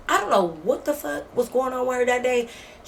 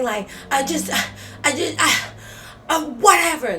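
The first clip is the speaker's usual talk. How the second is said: mock stuttering; 6 semitones higher than usual. Imitating a flustered roommate stuttering as she gives in. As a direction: stumble over the start, then burst out. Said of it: "Like, she's stuttering".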